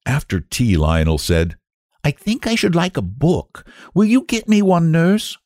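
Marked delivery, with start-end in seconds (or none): 2.1-5.4 put-on high-pitched child asking politely; very high young boy's voice; little boy king. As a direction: ask nurse as a young boy would. Child king asks nurse.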